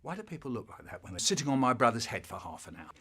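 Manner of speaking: ordinary talk, nothing marked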